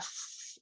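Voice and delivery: short, soft voiceless hiss; snake hiss. Shown as a demonstration. Kids repeat the snake sound to teacher, soft hiss. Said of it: "she does her snake sound".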